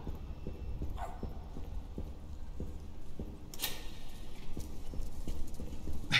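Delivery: airy, voiceless panicked laugh; breathless laugh. Real, not acted. Laughing after the escape.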